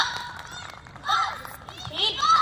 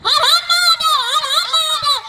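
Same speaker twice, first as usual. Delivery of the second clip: high, loud battle cry; triumphant shout; 9 semitones higher, 8 dB louder, much faster than usual. Acted. Praising Shiva after he drinks the poison, a loud triumphant shout. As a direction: roar the praise of Mahadev.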